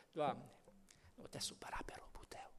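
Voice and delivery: hushed, fast whispered prayer; whispered plea. Acted. Moses begs God not to leave, in a whisper. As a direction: breathe it as a timid plea.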